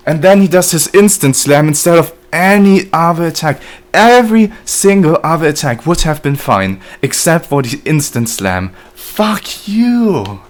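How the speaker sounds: high, loud exasperated rant; really upset. Real, not acted. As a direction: rage at the unfair game. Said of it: "Sorry, I'm getting really upset".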